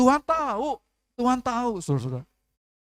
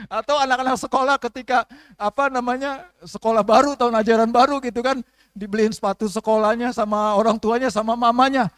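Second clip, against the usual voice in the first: normal talking voice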